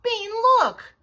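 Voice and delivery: loud, high exasperated snap; sharp outburst of annoyance; angry snap. A narrator's reading. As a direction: snap at Bean, voice leaping up. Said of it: "snapped Nancy".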